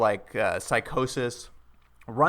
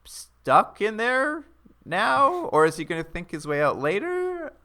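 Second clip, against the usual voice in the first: much higher, drawn out; high incredulous; 5 semitones higher, slower than usual. Retold or imitated. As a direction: stretch it out in disbelief. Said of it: "we're just like".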